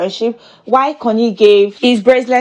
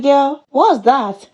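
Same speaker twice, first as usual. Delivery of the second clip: slow, drawn-out side-eye "what's that"; sassy disbelief; 5 semitones higher, much slower than usual. Retold or imitated. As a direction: stretch it out like you can't believe the eye-roll. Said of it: "I'm like".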